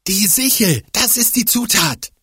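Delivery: hoch, überrascht erkennend; aufgeregt. Acted. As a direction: die Lösung plötzlich sehen. Fiete entdeckt Zutat. (aufgeregte Erkenntnis)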